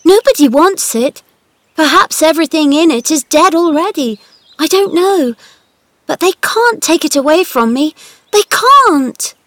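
Mary clinging hard to her garden; defiant girl. Acted. Mary's defiant outburst.

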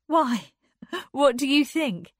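high, loud and lightly laughing; lifted, amused counter-question; laughing reply. A narrator's reading. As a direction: toss the question back with a laugh. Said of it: "she laughed".

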